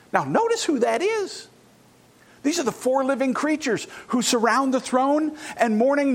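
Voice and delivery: normal talking voice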